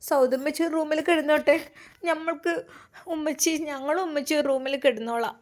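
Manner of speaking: a hint of a little girl pleading; child voice. A narrator's reading. Little daughter asking her father, lightly childlike.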